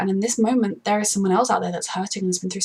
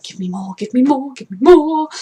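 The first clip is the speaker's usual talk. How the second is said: escalating greedy plea; building demand; 4 semitones higher, 5 dB louder than usual. Retold or imitated. To the show, begging for more, building louder.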